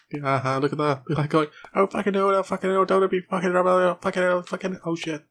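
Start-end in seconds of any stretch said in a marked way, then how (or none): 1.7-5.2 high, panicky put-on cursing voice; imagined game character's voice. Player voicing the on-screen character in panic, high put-on voice. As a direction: squeal the swearing as the imagined little character. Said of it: "it's just how I imagine him speaking".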